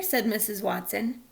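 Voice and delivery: ordinary delivery, nothing marked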